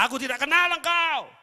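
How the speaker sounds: voice of the Lord. Retold or imitated. Pastor quoting God rejecting the proud believer, booming verdict. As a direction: pronounce it as God rejecting them. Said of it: "Jangan kaget kalau Tuhan bilang apa".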